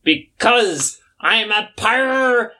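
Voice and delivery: high hammed-up pirate growl; pirate salamander. Salamander calling to crewmate for drinks, pirate voice. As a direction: bellow it like a tipsy pirate captain.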